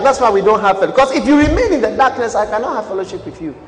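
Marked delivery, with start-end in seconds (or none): none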